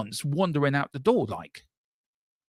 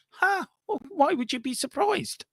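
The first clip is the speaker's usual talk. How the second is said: much higher, mocking surprise; sarcastic disbelief; 13 semitones higher than usual. Real, not acted. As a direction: jump up in pitch, scoffing at the news. Mock disbelief at news.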